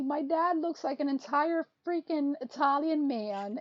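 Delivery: ordinary delivery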